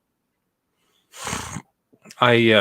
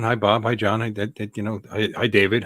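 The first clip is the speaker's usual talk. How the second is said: normal talking voice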